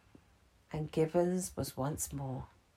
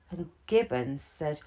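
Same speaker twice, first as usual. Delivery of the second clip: usual speaking voice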